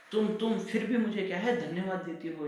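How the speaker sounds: high, loud outraged cry; angry shout. Acted. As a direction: shout in disbelief at her thanks. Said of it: "ऊंचे स्वर में गुस्से में".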